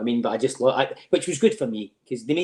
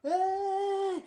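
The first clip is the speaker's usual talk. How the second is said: crowd roar. Retold or imitated. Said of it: "they all went".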